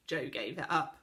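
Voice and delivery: ordinary delivery, nothing marked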